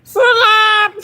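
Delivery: high, loud squeaky shout. Pretend. Showing off a silly voice, high squeal. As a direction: yelp it like a tiny bird. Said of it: "Imagine if I talked like that".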